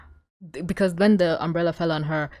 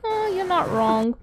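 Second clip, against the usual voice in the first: smitten mimicry; 11 semitones higher, slower than usual. Voicing Marinette's smitten reply.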